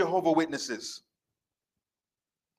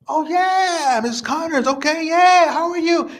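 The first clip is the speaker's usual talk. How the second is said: much higher, louder fake-cheerful recognition; mock enthusiasm; 12 semitones higher, 6 dB louder than usual. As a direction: overplay the delighted surprise. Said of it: "fake excited greeting".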